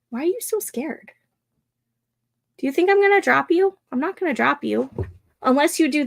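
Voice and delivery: dog talk. Real, not acted. Teasing the scared dog.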